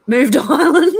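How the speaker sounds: breaking into a laugh mid-sentence; words bubbling into real laughter. Real, not acted. Streamer reads viewer's comment about moving to Ireland, breaking into laughter.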